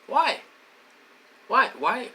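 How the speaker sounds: loud, high repeated why; exasperated cries. Real, not acted. Pleading with viewers to explain why, exasperated cries. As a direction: hurl each why out in disbelief.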